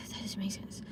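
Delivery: hushed disbelieving whisper; whispered retort. Retold or imitated. Retelling reply to store clerk.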